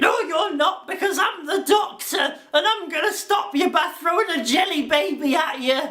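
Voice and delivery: high, proud hero voice; triumphant Doctor. Pretend. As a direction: declare it like a cocky hero. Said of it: "the Yorkshire Doctor is like".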